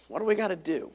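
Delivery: a quiet, searching echo of the question. Shown as a demonstration. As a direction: drop to a near-hushed repeat of the question. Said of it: "Well, what do we gotta do?".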